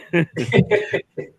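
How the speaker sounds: airy high laugh at his own joke; real amused chuckle; breathy laugh. Real, not acted.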